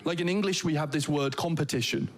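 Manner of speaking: ordinary talk, nothing marked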